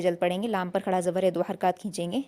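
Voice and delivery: ordinary talk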